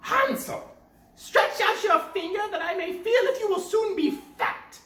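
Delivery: high loud witch voice; witch calling. Acted. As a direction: croon it like a witch luring Hansel. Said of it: "crept to the little stable and cried".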